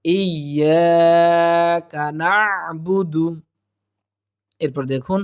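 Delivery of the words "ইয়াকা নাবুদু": "ইয়াকা না'বুদু" very slow, doubled y, long "yaa", throaty ayn; correct tajweed. Shown as a demonstration. Teacher modelling the verse, careful tajweed. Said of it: "ঠিক এরকম একটা আওয়াজ আসবে".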